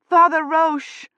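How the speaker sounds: high loud desperate call; desperate cry. A narrator's reading. Woman calling for the priest in terror, desperate cry. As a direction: cry out for help. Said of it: "she cried desperately".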